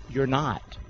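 ordinary talk

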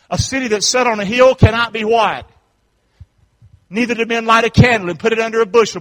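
loud preacher quoting scripture; forceful scripture preaching. Real, not acted. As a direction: proclaim the verse high and strong.

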